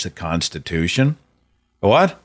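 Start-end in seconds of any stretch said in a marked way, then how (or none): 1.8-2.2 sharp high disbelieving what; outraged disbelief; incredulous. Real, not acted. Outraged disbelief at Trump quote.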